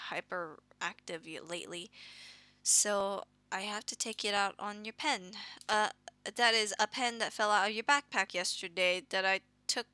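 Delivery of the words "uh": normal talking voice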